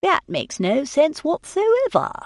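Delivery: a lightly voiced peevish gentleman; complaining. A narrator's reading. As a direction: whine that it's nonsense. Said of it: "Tilney complained".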